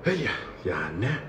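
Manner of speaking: low, soft doubtful muttering; skeptical mutter. Retold or imitated. Comedian doubting the excited inmates, low soft skeptical mutter. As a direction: say it under your breath, unconvinced. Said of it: "I'm sitting there like".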